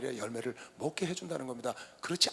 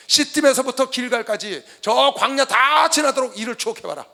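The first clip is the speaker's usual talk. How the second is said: loud, raised, drawn-out declamation; slow, forceful proclamation; impassioned scripture reading; 5 semitones higher, 15 dB louder, slower than usual. Acted. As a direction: stretch the words across the whole wilderness. Reads scripture with preaching force.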